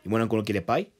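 ordinary delivery

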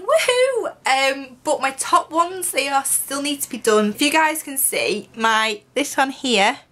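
pointing at own teeth; talking with finger at lips; finger on front tooth. Real, not acted. Seen 3: Finger pressed on upper front tooth through 1.6s, gone by 1.8.

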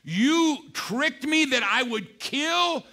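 king turning on Haman; furious accusation. Retold or imitated. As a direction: drag out each word in rising fury. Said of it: "And he was furious".

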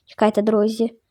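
normal talking voice

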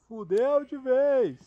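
long slow yell of doom; drawn-out shout. Pretend. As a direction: bellow it out slowly. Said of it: "Pode dizer".

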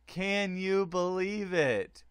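slow, stressing you, falling; stunned disbelief. Real, not acted. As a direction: let the disbelief sink in slowly. Repeating stunned result.

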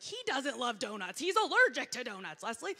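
loud, scolding retort; exasperated self-scolding. Retold or imitated. Inner voice scolding Leslie about the allergy, loud exasperated. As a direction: scold yourself by name. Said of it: "And it's like".

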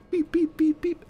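high, even phone beeps; imitated dial tones. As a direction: beep like keys being dialled. Imitates dialling the FBI friend.

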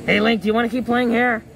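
loud call across the room. Pretend. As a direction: call out to a friend. Calling to Link across room.

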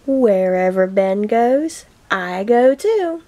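ordinary delivery, nothing marked

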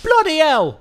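loud, high British "Bloody hell!"; sudden British outburst; British accent. Acted. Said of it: "speaking with a British accent".

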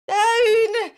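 high, loud cry of "Down!"; witch's cry. A narrator's reading. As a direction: shout it as the witch. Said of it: "cried the witch".

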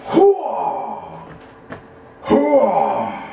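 gung-ho army battle cry. Acted. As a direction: bark it like a drill soldier.

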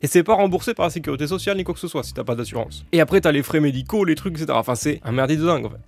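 ordinary talk

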